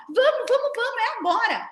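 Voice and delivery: loud, very high mock nagging; imitating a pushy relative rushing everyone; impatient hurrying. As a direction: hurry someone along, hammed up. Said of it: "chega e fala assim".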